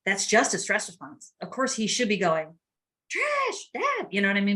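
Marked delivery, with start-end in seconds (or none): 3.1-4.1 urgent call. Pretend. Son alerting his parents that his brother fainted, mock alarmed call. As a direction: pretend panicked call, pitched far up. Son should be calling for help.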